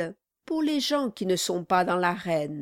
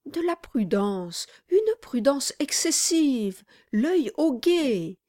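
eager exclamation; 5 semitones higher than usual. Acted. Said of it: "s'écria Brass".

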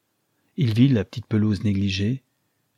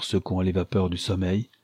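ordinary delivery, nothing marked

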